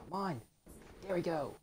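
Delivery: high, loud triumphant cheer; excited cheer. Real, not acted. Gamer landing a boss hit, loud cheer.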